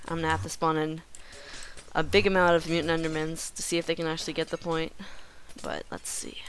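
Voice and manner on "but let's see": low hushed whisper. Real, not acted. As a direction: breathe it out low and secret. Whispered aside.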